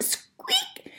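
very high, thin mouse squeak in place of a roar; tiny squeak. Acted. Mouse trying to roar but only squeaking, high. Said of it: "what I've come to you for is squeak".

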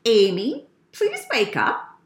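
mother's nice, pleading wake-up call; very nicely. Acted. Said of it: "said very nicely".

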